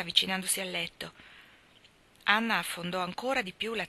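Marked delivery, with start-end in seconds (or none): none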